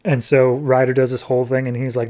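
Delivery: usual speaking voice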